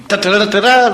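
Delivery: high yapping ta ta; imitated dog bark. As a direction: yap like a tough little dog. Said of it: "like Rin Tin Tin or something".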